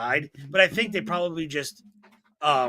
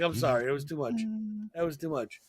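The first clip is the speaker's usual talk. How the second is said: retold sheepish apology; 2 semitones lower, 9 dB quieter than usual. Grandson to shaken grandmother after the prank, soft acted remorse. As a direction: apologise quietly, voice dropped.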